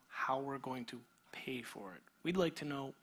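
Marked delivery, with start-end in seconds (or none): none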